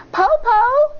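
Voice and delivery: high, loud call up to grandma. A narrator's reading. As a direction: call down from the treetop. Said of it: "Shang called out".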